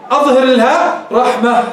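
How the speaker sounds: slow, with the h of رحمة made audible; clear final ha. Shown as a demonstration. Tajweed teacher tells students to show it, clear h. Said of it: "خلها تظهر الهاء".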